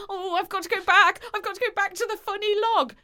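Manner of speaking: high, fast, panicky; panicky mum. Retold or imitated. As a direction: squeak it out in a panic. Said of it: "She's like".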